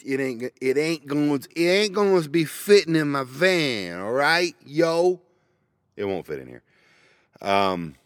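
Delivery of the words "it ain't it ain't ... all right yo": slangy street voice. Pretend. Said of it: "people actually talk like that".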